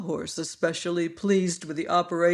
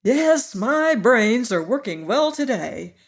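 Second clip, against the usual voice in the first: loud, higher and pleased with himself; proud; 6 semitones higher, 7 dB louder than usual. A narrator's reading. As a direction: puff up with pride. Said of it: "in an accent of pride in his voice".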